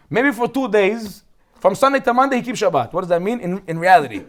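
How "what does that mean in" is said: usual speaking voice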